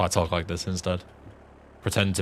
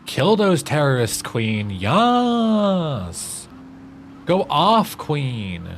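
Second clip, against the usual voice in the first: campy slay cheer; 7 semitones higher, much slower than usual. Pretend. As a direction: hype the character up like a camp fan.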